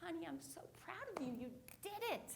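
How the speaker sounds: tender mom. Retold or imitated. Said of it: "and she says".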